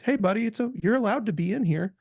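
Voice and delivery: lightly voiced soothing of an inner child; tender self-talk. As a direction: talk kindly to your younger self. Therapist voicing kind self-talk.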